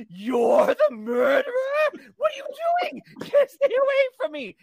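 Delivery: hammed-up scared cry; mock terrified Rosie. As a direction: recoil from a killer in fake panic. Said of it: "And she said".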